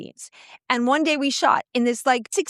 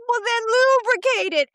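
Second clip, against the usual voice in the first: director; 9 semitones higher than usual. Retold or imitated. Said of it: "our dear director, goes".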